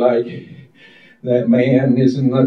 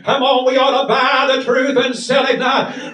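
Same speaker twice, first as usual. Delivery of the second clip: full-force preaching; 12 semitones higher than usual. Real, not acted. Preaching at full force over music.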